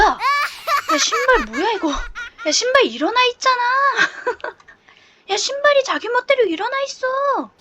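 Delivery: high-pitched, cracking up in disbelief; real giggles breaking up the words; laughing. Real, not acted. Player spots shoes standing up by themselves, laughing.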